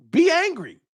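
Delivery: mock-sweet high taunt. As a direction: toss Be angry up high, teasing. Mocking the angry fan.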